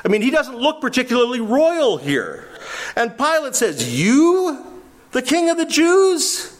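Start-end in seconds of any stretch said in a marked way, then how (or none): 3.9-6.6 mocking disbelief. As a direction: scoff at the beaten prisoner. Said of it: "demeaning and insulting".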